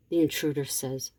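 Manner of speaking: ordinary delivery, nothing marked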